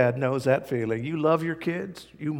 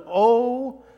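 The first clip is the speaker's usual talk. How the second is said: passionate oh; 7 semitones higher, 4 dB louder than usual. Retold or imitated. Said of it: "you know they're getting passionate".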